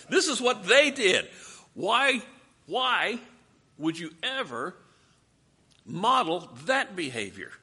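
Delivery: loud, high, emphatic preaching; forceful exhortation. Real, not acted. Preacher confronts congregation over Israel's example, forceful exhortation. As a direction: drive it home to the congregation.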